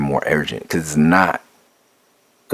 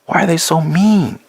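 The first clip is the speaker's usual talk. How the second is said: put-on high, pleading child's voice; upset child near tears; 13 semitones higher, 3 dB louder than usual. Son asking grandpa why police are mean, high tearful plea. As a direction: ask it like a little boy close to crying. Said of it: "and he's almost in tears".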